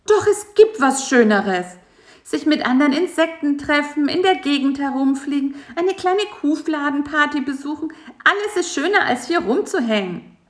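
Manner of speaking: high, eager insect voice. A narrator's reading. Bisi dreaming of parties, high and eager. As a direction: push excitedly, pitched high.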